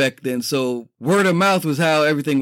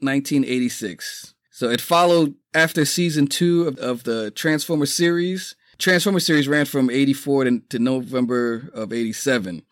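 ordinary delivery

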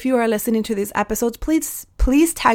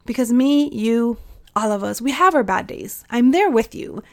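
normal talking voice

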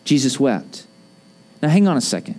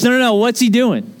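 normal talking voice

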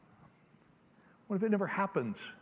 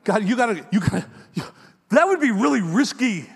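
pleading Abram; 5 semitones higher, 7 dB louder than usual. Acted.

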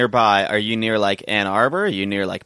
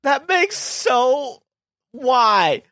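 much higher baffled why; incredulous; 11 semitones higher, much slower than usual. Real, not acted. Co-host baffled by the Michigan border story, high and incredulous. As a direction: plead in disbelief.

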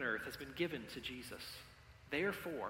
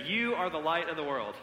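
pointed and louder, mock rude; loud playful jab; 6 dB louder than usual. Shown as a demonstration. Pastor leading a playful rude pointing exercise, loud and cheeky. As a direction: say it right at them, cheeky. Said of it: "point to your neighbor very rudely".

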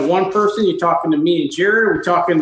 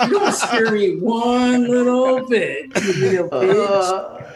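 put-on belligerent drunk; 3 semitones higher, slower than usual. Mock tough talk, laughing.

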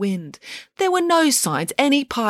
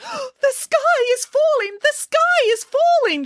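very high, slow wail of alarm; high-pitched, drawn-out storybook panic; panicked cry. A narrator's reading. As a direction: cry out like a hysterical little chicken. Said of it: "she cried".

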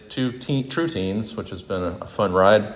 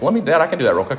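mock eager kid, higher and louder; eager son; 8 semitones higher, 4 dB louder, much faster than usual. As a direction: play the keen young son jumping in. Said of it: "was like".

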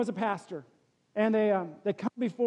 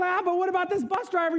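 higher, louder mock objection; pastor objecting; 11 semitones higher, 5 dB louder, faster than usual. Pastor questioning Peter, mock objection. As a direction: push back, hammed-up. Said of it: "And he goes".

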